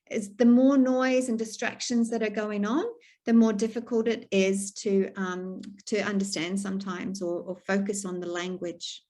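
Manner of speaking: normal talking voice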